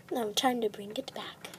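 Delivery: soft unvoiced aside; whispering. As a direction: whisper it to yourself. Witch aside while cooking.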